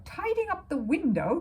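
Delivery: high, loud, incredulous splutter; detective spluttering at the bear's excuse. A narrator's reading. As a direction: splutter in disbelief. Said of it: "the detective spluttered".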